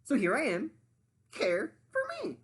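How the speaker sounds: high, loud demanding sandwich voice; high bossy sandwich lady voice. Acted. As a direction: play the pushy sandwich, high and grand. Sandwich character voice.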